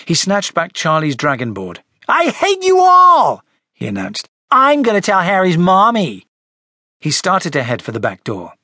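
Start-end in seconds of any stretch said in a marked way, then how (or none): 2.1-3.4 high, slow, loud shout; furious outburst. Acted. Frederick storming at the Go-Go's, furious high shout. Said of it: "Frederick was furious". 4.5-6.3 loud, high tattletale threat; furious threat. Acted. Frederick threatening to tell on the others, angry raised voice. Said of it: "Frederick was furious".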